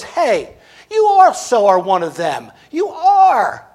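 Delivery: accusing, rising to a high, loud "You are"; loud accusation. Retold or imitated. Bystander accusing Peter, a loud high jab. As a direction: point the finger and nail him with it.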